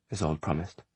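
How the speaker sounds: ordinary delivery, nothing marked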